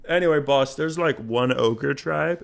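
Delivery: slow, offhand put-on voice, dragging the words; lazily voiced ally changing the subject; mock casual ally. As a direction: shrug it off like a lazy ally.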